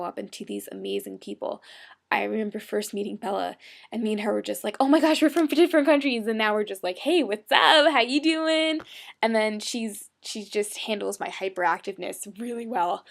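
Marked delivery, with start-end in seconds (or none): 4.8-6.3 higher, louder retold excitement; excited. Retold excited first meeting. 7.0-8.8 high, loud mock hello; exuberant greeting. Retold casual greeting between friends.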